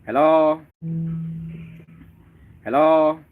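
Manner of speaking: normal talking voice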